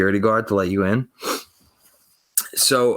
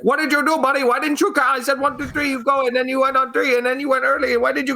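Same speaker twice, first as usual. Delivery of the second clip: very high, frantic scolding from the guard; mock the guard's high-pitched rant, firing accusations nonstop; imitated yelling. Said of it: "he's yelling".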